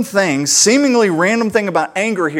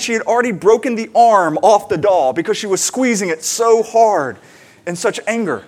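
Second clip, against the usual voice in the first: ordinary talk, nothing marked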